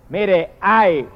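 ordinary talk, nothing marked

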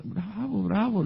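high mock applause cries; cheering ladies. Ladies applauding the raffle winner, mock cheering. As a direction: imitate genteel cheers, pitched high.

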